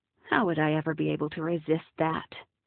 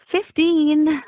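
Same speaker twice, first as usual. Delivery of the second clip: breathless; 9 semitones higher, 8 dB louder than usual. Acted. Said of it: "I corrected breathlessly".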